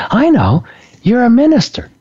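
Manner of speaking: imitating a son's bright idea, pitch jumping high; brightening up, smiling. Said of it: "he brightens up, smiles".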